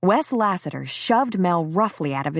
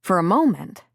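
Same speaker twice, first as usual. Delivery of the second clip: ordinary delivery, nothing marked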